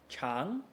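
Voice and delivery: "Chang" alone, softly rising; isolated second tone on "Chang"; rise then reset. Shown as a demonstration.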